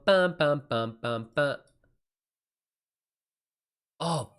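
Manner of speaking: ordinary talk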